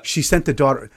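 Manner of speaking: usual speaking voice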